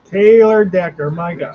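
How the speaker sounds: loud, fed-up groan of the name; exasperated outburst. Real, not acted. Fan yelling at his team's tackle for the penalty, exasperated loud. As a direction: blurt the name in disgust.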